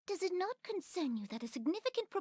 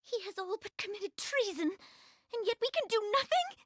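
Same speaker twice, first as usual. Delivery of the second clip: rising, higher and higher in distress; anguished indignation; 8 semitones higher than usual. Acted. Young queen to her general, outraged at helpless law, anguished high cry. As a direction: cry out against the injustice, voice climbing. Queen despairs over unpunished treason.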